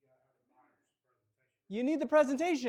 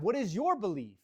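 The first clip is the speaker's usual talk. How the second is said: ordinary delivery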